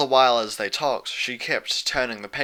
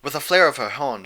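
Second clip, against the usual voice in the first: ordinary delivery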